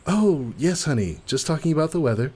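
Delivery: high, fast, airy cover-up; breezy wife. Acted. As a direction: brush it off sweetly. Wife answers with a lie.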